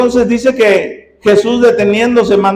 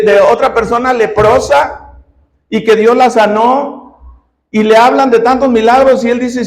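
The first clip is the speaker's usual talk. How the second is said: ordinary talk, nothing marked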